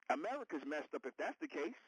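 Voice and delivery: ordinary talk, nothing marked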